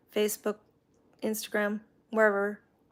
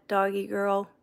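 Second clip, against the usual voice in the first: ordinary talk, nothing marked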